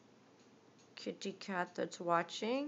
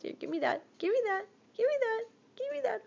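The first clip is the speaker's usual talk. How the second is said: rising higher, louder each time; tease, climbing up each repeat; building playful demand; 12 semitones higher, 6 dB louder, much faster than usual. Real, not acted. Building playful tug-of-war.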